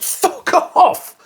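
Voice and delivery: mock outrage. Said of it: "but I was like".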